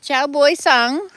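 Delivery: "Chào buổi sáng" called out high and loud with its Vietnamese tones; loud, high Vietnamese "Chào buổi sáng" for the learner; Vietnamese greeting demo. Shown as a demonstration. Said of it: "how do you say good morning again?".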